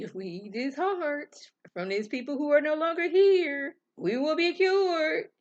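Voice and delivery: high, rising retold proclamation; mock proclamation. Retelling pastor proclaiming to everybody.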